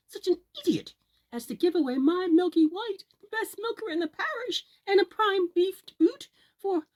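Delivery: high, loud scolding shriek; furious mother. Acted. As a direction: tear into him for the cow. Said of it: "says Jack's mother".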